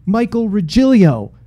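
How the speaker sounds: high, loud mock excited teen; excited girl. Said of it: "She's like".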